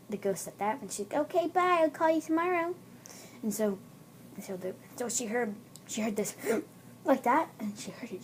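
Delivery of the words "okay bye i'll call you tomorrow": put-on high girl voice; phone goodbye. Girl ending a phone call, high acted voice. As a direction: sign off in a high voice.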